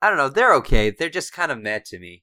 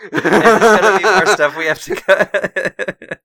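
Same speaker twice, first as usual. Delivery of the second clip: loud laughing question, drawn out; 8 semitones higher, 12 dB louder, slower than usual. Real, not acted. Co-host teasing about cuts to the episode, laughing. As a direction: ask it through a big laugh.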